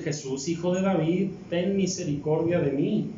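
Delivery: normal talking voice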